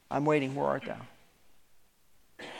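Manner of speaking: soft, very slow calling of a waiting God; God calling softly. Acted. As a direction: draw it out quietly, still waiting for an answer. God still calls out the sinners.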